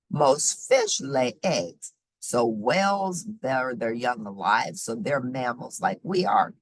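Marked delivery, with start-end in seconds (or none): none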